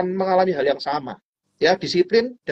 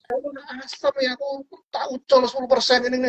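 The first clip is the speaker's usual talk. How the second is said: mock grumbling; 6 semitones higher than usual. Friend who sold at ten percent complains, mock annoyed. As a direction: grumble in a high, put-on voice. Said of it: "Marah-marah loh".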